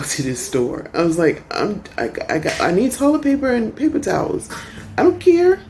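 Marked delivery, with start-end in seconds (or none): 1.6-5.6 exasperated. Retold or imitated. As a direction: blurt it out like you just want your toilet paper. Retold own flustered reply at checkout.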